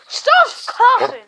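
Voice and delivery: high, loud, slow telling-off; mock scold. Real, not acted. Playfully scolding the dog for coughing in her video.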